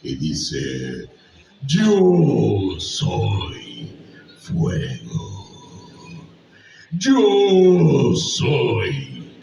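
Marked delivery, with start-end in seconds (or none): 1.7-9.3 Smaug voice. Shown as a demonstration. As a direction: roar it like a dragon. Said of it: "así es como suena la voz de Smaug".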